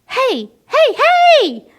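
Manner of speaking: frog yelling. A narrator's reading. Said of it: "yelled the frog".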